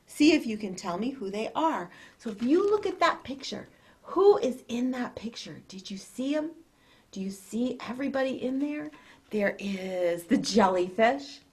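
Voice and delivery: ordinary delivery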